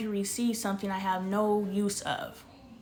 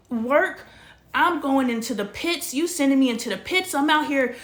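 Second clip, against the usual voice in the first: louder and higher, shouting her frustration; furious rant; 4 semitones higher, 6 dB louder than usual. Real, not acted. Venting to the camera about her stalled work, in a furious shout. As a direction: let the anger break loose and climb.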